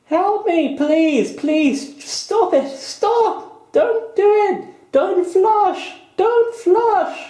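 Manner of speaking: shrill high screams for help, very loud; panicked screaming. Pretend. Someone in a homicidal toilet begs not to be flushed, screaming high. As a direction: shriek and beg like a victim about to be flushed. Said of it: "my toilet has homicidal tendencies".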